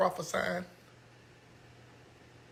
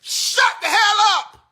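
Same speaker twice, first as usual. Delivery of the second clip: slow, loud, high shout; fed-up shout at the crowd; angry shout; 14 semitones higher, 8 dB louder, slower than usual. Real, not acted. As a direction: bark it out, fed up. Annoyed at screaming crowd.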